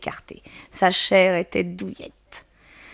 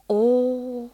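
long, higher cry of admiration; exclaiming; 4 semitones higher than usual. A narrator's reading. Madame Dehan seeing her tenant rise, admiring exclamation. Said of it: "s'écria madame Dehan".

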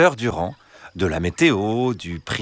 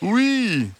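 gentle, reassuring fox; 13 semitones higher than usual. Acted. Fox asking three hens to let him in, gentle and reassuring. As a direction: coax them sweetly in a high drawn-out voice. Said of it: "Une voix douce et rassurante".

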